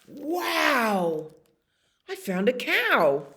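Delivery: high, drawn-out wonder; thrilled, high-pitched exclamation; amazed delight. Acted. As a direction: gush as if a wish came true. Dog learns it's a cow.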